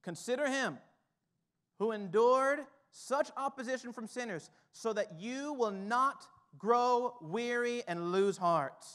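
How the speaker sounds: ordinary delivery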